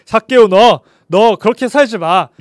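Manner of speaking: loud, stern pretend telling-off; mock scolding. Pretend Jesus scolding Zacchaeus to change, loud and stern. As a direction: scold him like a strict teacher.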